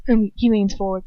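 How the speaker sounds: ordinary delivery, nothing marked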